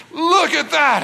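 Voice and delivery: high, emphatic burst of awe; acted dad's excitement; awestruck exclaiming. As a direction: show off something huge to the kids. Dad showing kids the big ride.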